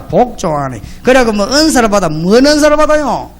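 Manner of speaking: usual speaking voice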